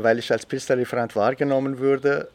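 usual speaking voice

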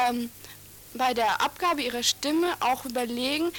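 usual speaking voice

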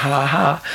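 sneering schoolboy laughter, imitated; mocking laugh. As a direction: imitate teenagers laughing at someone who fell. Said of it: "die einfach sagen".

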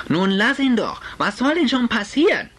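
hoch und laut beschwichtigend; laute Mutter. Acted. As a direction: halt laut und flehend dagegen. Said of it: "eine recht laute Unterhaltung". (Mutter verteidigt Richard, hoch und laut)

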